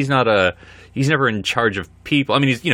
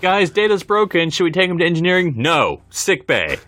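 mock alarm; 7 semitones higher than usual. Mock alarm about broken Data.

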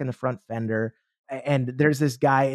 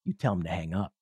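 lower, softer, matter-of-fact order; bold inner command; 9 semitones lower, 7 dB quieter than usual. Acted. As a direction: calmly dare yourself. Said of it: "that voice came up again".

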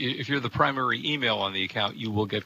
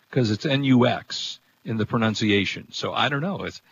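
normal talking voice

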